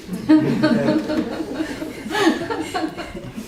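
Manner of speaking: high, far-off mocking cackle, pulled back in level; evil spirit cackle. Exposed spirit cackles at the pastor, high mocking laugh. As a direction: let the caught spirit cackle at being found out. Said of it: "웃으면서".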